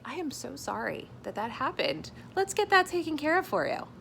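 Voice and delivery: lightly voiced polite service rep; customer service voice. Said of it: "having to say".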